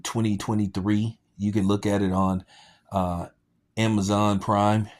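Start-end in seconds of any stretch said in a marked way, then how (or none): none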